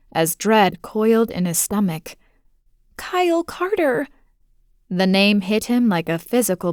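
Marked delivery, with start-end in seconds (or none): 3.0-4.1 put-on scared little girl. Girl naming the kidnapper.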